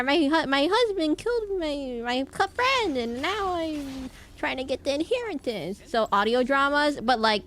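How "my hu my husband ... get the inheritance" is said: higher falsetto lady voice, sliding back down; 1920s radio drama lady. Retold or imitated. Streamer mimicking a 1920s radio drama widow, falsetto fading. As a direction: keep the old-timey damsel going as the act slips. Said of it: "Detective noir is like".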